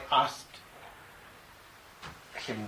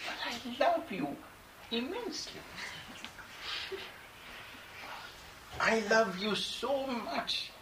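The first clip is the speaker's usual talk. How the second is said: tender loving reply; 12 semitones higher than usual. Retold or imitated. To the aggressive young man, a high tender declaration of love. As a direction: melt him with love, pitched up high.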